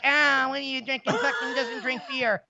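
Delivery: slow, sneering mimic of the guys; mocking sneer. Mimicking friends who mock his drink, slow sneering voice. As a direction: drag it out like a snob looking down. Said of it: "look down on me and be like".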